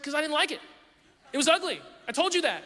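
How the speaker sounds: mock defensive wife. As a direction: fire back that she already said so.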